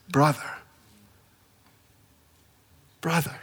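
soft and hushed, lingering on each word; like welcoming a lost brother home; tender greeting. Retold or imitated. Ananias softly greeting his former persecutor, tenderly.

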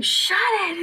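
high imitated yell; mock shouted command; Ginny. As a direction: snap it out to silence a noisy match. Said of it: "it's like Ginny going".

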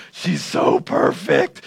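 slow, breathless awed whisper. Retold or imitated. Adam gazing at his bride, awed whisper. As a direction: whisper it in mock awe. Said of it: "He says".

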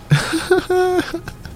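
shrill giggle; high squealing laugh. Real, not acted. Vlogger laughing at needing therapy, high squeal.

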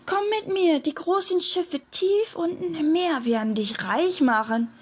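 high mermaid voice; luring mermaid. A narrator's reading. Mermaid promising the pirate riches below, luring. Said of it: "rief die Meerjungfrau".

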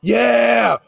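loud, high cheer at the game; excited shout. Real, not acted. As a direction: yell it out in celebration. Celebrating a long field goal.

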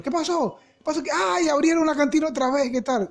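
high-pitched mock alarm; profesor alarmado. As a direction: exclaim like a teacher discovering a break-in. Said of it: "escuchábamos al profesor". (imitando al profesor, agudo y alarmado)

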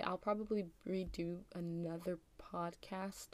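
ordinary delivery

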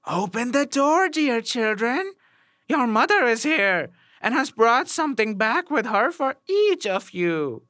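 high, sweetened mock-mother voice; wolf's softened voice. A narrator's reading. Wolf back at the door posing as mother, high softened voice. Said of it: "made his voice soft with it".

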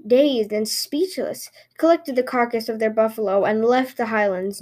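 plain reading voice

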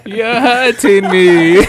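loud, drawn-out, laughing wail; mock victim cry. Prankster's victim in the invisibility bit, hammed-up cry. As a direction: cry out slowly in hammed-up pain.

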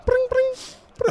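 high-pitched bring bring ringtone; playful imitated phone ring; mock phone ring. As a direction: squeak it out like an old phone ringing. Mimics a phone ringing.

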